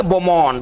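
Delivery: बोमोन as "bo-mon"; बोमोन in two beats, "bo" then "mon"; correct French pronunciation. Shown as a demonstration. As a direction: say बोमोन without any d at the end. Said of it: "इसको पढ़ेंगे".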